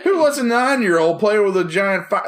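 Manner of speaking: high, loud incredulous question; mock outrage. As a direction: blurt it in exaggerated disbelief. Repeating his outraged question.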